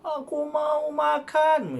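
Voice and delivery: high, drawn-out demand to mum; slow, high-toned example of rude asking; high-pitched tone. Shown as a demonstration. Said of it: "Nadanya tinggi".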